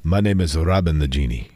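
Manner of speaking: low, grand magic genie voice; Robin the Genie voice. Shown as a demonstration. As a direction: boom like a genie from a bottle. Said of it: "I'm going to make up a genie voice now".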